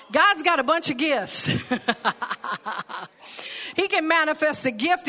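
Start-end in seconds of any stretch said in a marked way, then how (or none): 1.5-3.0 short, low chuckle in bursts; laugh. Real, not acted. Preacher amused by his quip about gifts, chuckling. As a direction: chuckle at your own joke.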